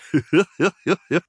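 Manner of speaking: high, squeaky cackle; acted jeering mouse laugh; mocking laugh. Prankster mocks his cousin, high cackle.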